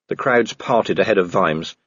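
ordinary delivery

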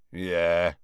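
low, easygoing reply; unconcerned. A narrator's reading. Hagrid replying to Harry's question, unconcerned.

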